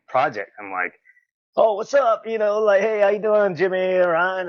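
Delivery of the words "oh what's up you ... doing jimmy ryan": high-pitched, chummy hello; sing-out greeting, well above usual pitch; eager greeting. Retold or imitated. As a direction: reenact an overexcited introduction.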